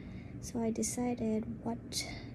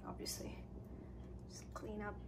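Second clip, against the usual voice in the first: soft, breathy whisper; whispered aside; 16 dB quieter than usual. Real, not acted. Aside about cleaning.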